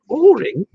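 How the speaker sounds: "Ahhing" said as "oring", high and questioning; mimicked mispronunciation. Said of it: "That's like".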